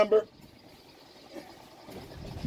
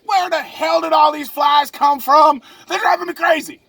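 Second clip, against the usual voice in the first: exasperated outburst; 10 semitones higher than usual. Acted. Boater swarmed by biting flies, exasperated. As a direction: cry out in mock exasperation.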